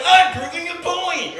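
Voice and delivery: high, loud, slow proud declaration; defiant declaration. Retold or imitated. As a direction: announce it loudly, full of pride. Retold answer to the clerk.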